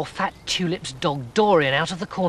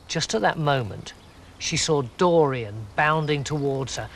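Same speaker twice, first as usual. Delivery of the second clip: plain reading voice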